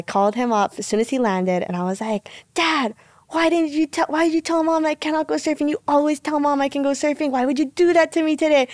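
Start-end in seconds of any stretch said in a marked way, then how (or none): 2.5-8.7 high-pitched protest to a parent; retold teenage complaint; pleading complaint. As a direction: challenge dad in a higher, aggrieved voice. Retold confronting dad on phone.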